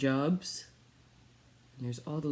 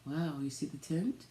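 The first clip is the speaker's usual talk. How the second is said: storybook reading; 4 semitones higher than usual. Acted. Reads picture book aloud.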